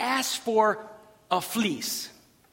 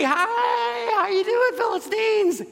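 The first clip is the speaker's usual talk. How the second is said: high, drawn-out cheery holler; calling out; 11 semitones higher, 3 dB louder, slower than usual. Acted. Jonathan hailing the Philistines from the ravine, high call. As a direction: holler a mock greeting far across a ravine.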